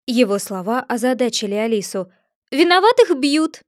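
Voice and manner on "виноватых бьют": boastful egg, higher and louder; haughty storybook braggart; pompous Humpty Dumpty. A narrator's reading. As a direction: puff yourself up, grand and condescending.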